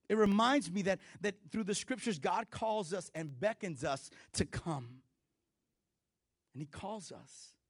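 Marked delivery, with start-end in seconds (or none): none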